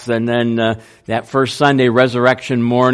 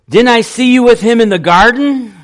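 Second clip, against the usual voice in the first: a pointed, higher and louder challenge; accusing servant; 9 semitones higher, 6 dB louder, faster than usual. Acted. Servant girl confronting Peter in the courtyard, accusing. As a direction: call him out as one of them.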